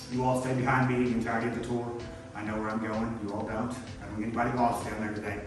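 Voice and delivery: usual speaking voice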